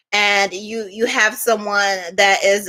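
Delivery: normal talking voice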